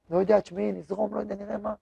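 fading, noncommittal shrug of a man with no plan; clueless groom muttering off; mumbling indecision. Retold or imitated. Man without direction answers.

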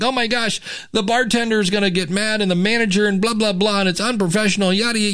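high, rushed worrying, retold; super stressed out. Bandleader fretting that they go on late, super stressed out. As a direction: reel off your panicky worries in a mock fret. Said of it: "I would get super stressed out".

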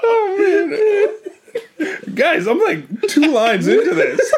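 cracking up, barely talking; laughing through the words. Real, not acted. Breaking up while reading.

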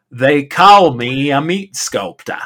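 slowly hamming up the burger man's pride; imitating the proprietor. Podcaster quoting the restaurant owner, lightly hammed. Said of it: "the way he talks is, I will do it no justice".

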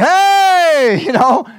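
high, loud quick Hey; dad sending someone off; mock dad shout. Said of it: "That's what my dad would have said".

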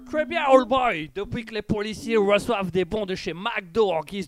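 high, excited on-the-spot reporter; field reporter. Pretend. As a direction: report live from the car.

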